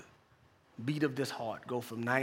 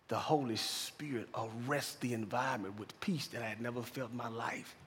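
ordinary talk, nothing marked

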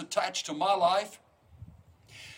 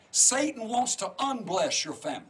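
ordinary talk, nothing marked

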